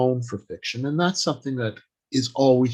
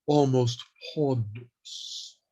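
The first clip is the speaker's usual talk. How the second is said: ponderous; 4 dB quieter, slower than usual. Shown as a demonstration. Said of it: "is very measured in their speech".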